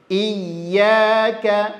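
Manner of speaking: "Iyyaka" with a pressed, held double y; tasydid ya. Shown as a demonstration. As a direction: lean on the yy of "Iyyaka". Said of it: "tasydid ya". ("Iyyaka" ditekan pada ya bertasydid)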